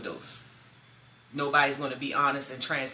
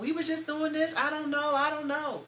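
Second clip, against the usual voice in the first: high, mock-innocent friend voice; a hammed-up playing-dumb friend; 11 semitones higher than usual. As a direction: play the clueless friend who knows nothing, pitched way up. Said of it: "talking about".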